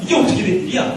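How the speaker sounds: high, loud burst of astonishment; startled amazement. Acted. Preacher voices the crowd hearing tongues, startled and amazed. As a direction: act out a stunned onlooker's disbelief. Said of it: "하고 깜짝 놀라고".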